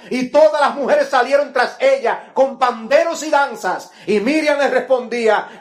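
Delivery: loud, high preaching of the scripture; thundering pulpit reading; fervent preaching. Acted. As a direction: proclaim the verses with fire. Preacher reads Miriam's song loudly.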